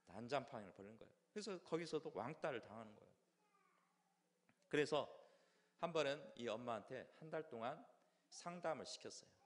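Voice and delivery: ordinary talk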